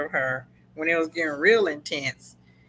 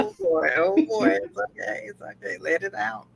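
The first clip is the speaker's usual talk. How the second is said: soothing comfort. Real, not acted. Comforting co-host who got emotional.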